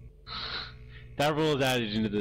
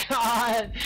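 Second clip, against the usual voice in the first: ecstatic shrieking with laughter; shrieking jackpot winner; 5 dB louder than usual. Real, not acted. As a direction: scream and laugh like you just won big. Winning a close race.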